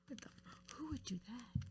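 whispered, voiceless mock disbelief; quoted reaction in a soft whisper; hushed disbelief. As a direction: whisper it as if baffled. Retells baffled reaction to question.

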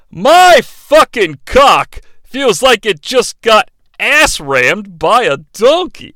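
slow, loud, drawn-out crude line; slow crude emphasis. Shown as a demonstration. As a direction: drag out each crude word slowly. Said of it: "playing Mad Libs with sex things".

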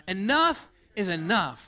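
slow, emphatic ENOUGH, full of attitude. Shown as a demonstration. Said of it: "say it with some attitude".

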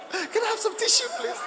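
high, squeaky fake weeping; hammed-up tearful request; mock tearful plea. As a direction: plead through pretend tears. Acted weeping wife asks for tissue.

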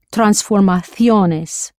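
normal talking voice

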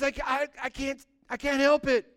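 high, stammering, flustered excuse; helpless protest. Acted. Helpless excuse to upset Balak.

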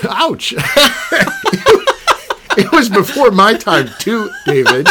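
high, loud protest through laughter; laughing mock outrage. Real, not acted. Host teased by guest about age, laughing protest. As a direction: burst out laughing as you object.

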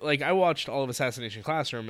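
normal talking voice

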